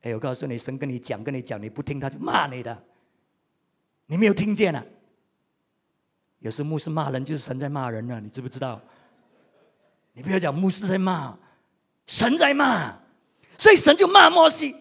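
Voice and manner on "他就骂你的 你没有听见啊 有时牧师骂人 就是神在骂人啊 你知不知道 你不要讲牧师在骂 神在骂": ordinary delivery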